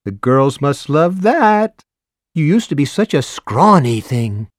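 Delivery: high, slow teasing busybody; flirty neighbor.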